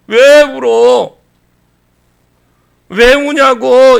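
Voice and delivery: angel questioning. Acted. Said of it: "천사가 뭐라고 합니까?".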